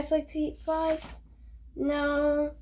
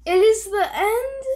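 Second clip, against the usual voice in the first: much higher, louder crying question; wailing Gerald; 9 semitones higher, 9 dB louder than usual. Acted. Gerald fearing the end, higher crying wail. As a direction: wail it as if all is lost. Gerald distraught, cry heard.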